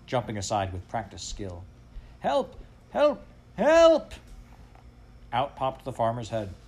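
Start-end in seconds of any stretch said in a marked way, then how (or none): 2.2-4.1 high alarm cries, building in volume; crying for help. A narrator's reading. Dog under master's window, crying for help. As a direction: yelp it high, ending on a loud shout.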